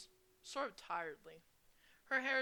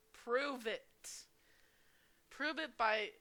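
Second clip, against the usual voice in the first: usual speaking voice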